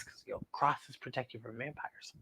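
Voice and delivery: secret whispered aside. Real, not acted. Aside about crosses.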